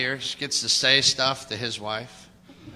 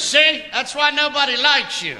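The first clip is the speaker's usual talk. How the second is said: high, deadpan put-down; dad's comeback; 12 semitones higher than usual. Retold or imitated. Dad answering his nagging wife, a lightly voiced put-down. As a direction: toss the insult off calmly. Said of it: "he looks at her and goes".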